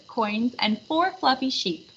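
ordinary delivery, nothing marked

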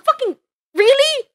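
squeaky high really; disbelieving yelp at chat; surprised. Real, not acted. Shocked at chat.

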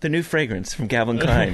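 low, hushed fragrance tagline; sultry perfume ad. Retold or imitated. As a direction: purr the brand name like a commercial. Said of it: "Brad Pitt fucking cologne commercial".

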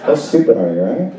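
ordinary delivery

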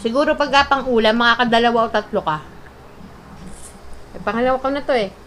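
eating chicken while speaking; chewing while talking. Real, not acted. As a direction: mouth partly full. Seen 2: Left speaker bites chicken at -2, jaw working, piece in hand throughout.

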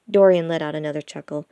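usual speaking voice

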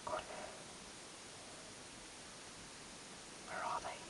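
faint tense whisper; whispering while hiding. Real, not acted. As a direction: hush it, they're close. Hiding in cupboard, seekers outside.

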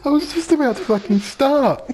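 high, cracking frustrated laugh; exasperated laughing. Real, not acted. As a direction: moan it through laughter. Exasperated at ruined take.

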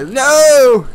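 surprised cry. Real, not acted. Reacting to opponent's move.

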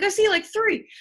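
high, loud surprised exclamation. Retold or imitated. Coach retelling her surprise at the count, excited. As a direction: blurt it out, caught off guard. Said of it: "I was like".

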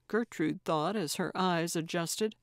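ordinary delivery

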